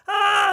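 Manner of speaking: high, loud mock scream; hammed-up scream. Pee-wee steering while Mickey lights up, panicked mock scream.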